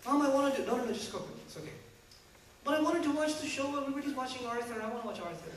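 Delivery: put-on child begging mom to watch Arthur, lightly whiny; whiny kid. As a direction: whine like a kid who wants cartoons. Child voice whining about show.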